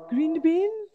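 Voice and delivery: high, loud questioning cry; mock-dramatic imitation, pitch jumping up at the end; hammed-up skit quote. Co-host quoting her favourite Halloween skit, hammed-up question.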